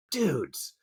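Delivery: usual speaking voice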